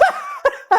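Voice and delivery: a sudden shrill giggle at the joke; high laugh. Real, not acted. Laughing at what the teacher said, high squeal.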